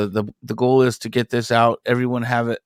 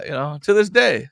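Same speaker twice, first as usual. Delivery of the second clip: normal talking voice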